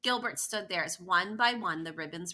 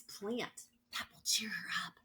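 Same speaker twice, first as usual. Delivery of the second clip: whispering; 4 dB quieter than usual. Acted. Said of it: "Patty whispered".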